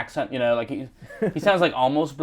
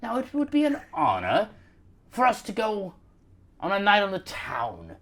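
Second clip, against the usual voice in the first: florid Victorian English gentleman, often high; Dickensian British; 4 semitones higher than usual. Acted. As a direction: woo a lady in grand old-fashioned British. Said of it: "like you're in a Charles Dickens book".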